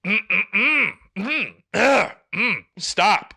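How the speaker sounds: hammed-up mock groan. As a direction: groan at them loudly to make them stop. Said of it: "So I just went".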